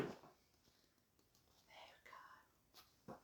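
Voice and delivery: breathy whispered aside; hushed aside. Real, not acted. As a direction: whisper it softly. Whispered aside while reading.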